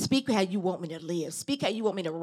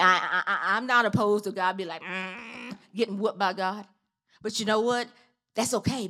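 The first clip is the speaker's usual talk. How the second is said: ordinary delivery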